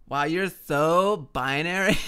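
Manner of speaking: put-on slow, loud Valley Girl put-down; mock-scornful Valley Girl; Valley Girl accent.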